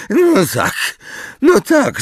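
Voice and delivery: high, squealy agreement; extremely excited. A narrator's reading. Umbridge agrees with Hermione, feverishly excited. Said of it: "której podniecenie sięgało zenitu".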